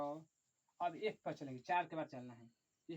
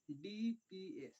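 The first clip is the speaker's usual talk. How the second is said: slow dictation; much slower than usual. Shown as a demonstration. Teacher spelling it out to students, slow and drawn out. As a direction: stretch out each letter slowly. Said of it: "फिर क्या लिखेंगे?".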